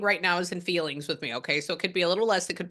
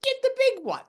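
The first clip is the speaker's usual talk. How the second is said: higher, breezy spirit voice; voicing the spirit; 14 semitones higher than usual. Medium relaying the husband's advice in a higher voice. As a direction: toss it off as the cheerful late husband. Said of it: "And so he's saying".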